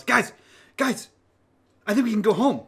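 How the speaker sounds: high-pitched, urgent call to friends; eager in-character shout, pitched well up; excited calling out. Acted. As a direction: get their attention, high and excited. Mitch calls excitedly to friends.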